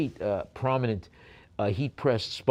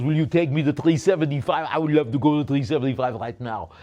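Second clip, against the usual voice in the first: very loud mock Austrian strongman; Arnold; 3 semitones higher, 9 dB louder than usual. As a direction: belt out the plea in a comic German accent. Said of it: "the Arnold voice".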